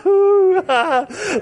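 high, breaking emotional laugh; a laugh that cracks into tears; choked laugh-sob. Real, not acted. Preacher overcome remembering the Lord on his porch, laugh-sob. Overcome recalling the Lord's visit.

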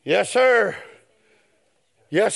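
ordinary talk, nothing marked

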